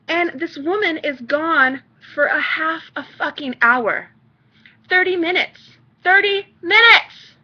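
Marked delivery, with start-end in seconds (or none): 4.9-7.4 rising outrage, higher and louder, slowing on the repeat; exasperated mother shouting at last; outraged. Real, not acted. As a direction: let the anger build as she repeats the time. Outraged rant peak.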